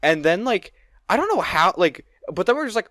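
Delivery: ordinary talk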